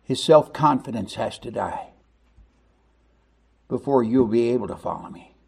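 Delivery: hushed, grave Jesus voice lightly voiced; Jesus quietly telling Peter the hard truth; Jesus speaking. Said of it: "Jesus said".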